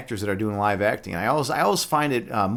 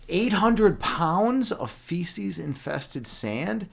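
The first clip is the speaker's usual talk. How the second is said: very slow, higher, rising disbelief; incredulous; 6 semitones higher, much slower than usual. Real, not acted. Streamer repeats buddy's chore back to him, incredulous. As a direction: draw it out as a stunned question.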